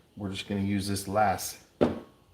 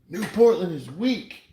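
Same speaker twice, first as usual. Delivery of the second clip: high, loud mock heckler's yell; imitated yelling; 11 semitones higher, 8 dB louder than usual. Coin maker quoting a yelling dude in downtown Portland, mock yell. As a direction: belt it out high like a street shouter. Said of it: "he started yelling at him".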